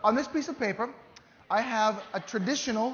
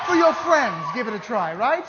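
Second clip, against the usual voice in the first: big showman's plea, pitched up; loud urging; 2 semitones higher, 10 dB louder than usual. Acted. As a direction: coax a shy volunteer at full voice. Urging a reluctant volunteer on stage.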